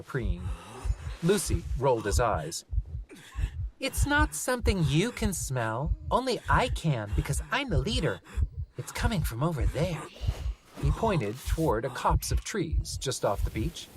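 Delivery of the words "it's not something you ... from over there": put-on child voice. Lucy child voice.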